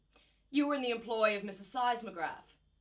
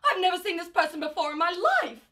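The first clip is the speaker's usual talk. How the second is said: frantic denial; 7 semitones higher, 5 dB louder, faster than usual. Acted. Mother denies evidence.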